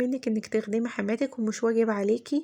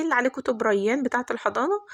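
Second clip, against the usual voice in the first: ordinary talk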